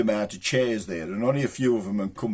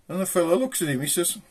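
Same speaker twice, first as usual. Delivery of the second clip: usual speaking voice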